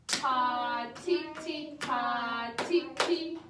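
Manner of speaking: chanted ta and ti, loud and even; rhythm syllables. Shown as a demonstration. Teacher leading kids in a loud rhythm chant. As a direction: clap along with each syllable. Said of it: "One, two, ready?".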